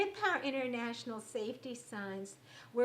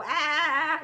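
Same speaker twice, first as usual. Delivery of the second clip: loud, higher, drawn-out "aah"; pretend upset yell; 4 semitones higher, 13 dB louder than usual. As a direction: let out a mock cry of being really upset. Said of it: "pretend that you got really upset".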